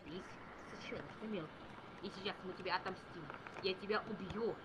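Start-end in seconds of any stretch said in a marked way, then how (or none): none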